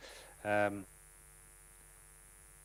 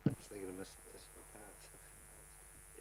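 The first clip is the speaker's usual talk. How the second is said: barely voiced whisper; whispered aside; 9 dB quieter, slower than usual. Real, not acted. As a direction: drop to a whisper for the name. Whispered aside while joking.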